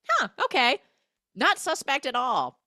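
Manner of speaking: sarcastic huh. Acted. J-Lo after the odd call, high mock-innocent sarcasm. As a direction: play dumb, dripping irony.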